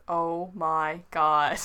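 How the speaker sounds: loud, slow, drawn-out groaning words; exasperated. Real, not acted. Reader flubbing the line repeatedly, exasperated. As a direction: let the frustration out slowly and loudly.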